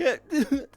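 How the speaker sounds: short, high, loud fake sob; mock sobbing. Imitating an ex's fake crying before it stops, mock sob. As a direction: imitate a burst of crocodile tears. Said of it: "she went from basically going".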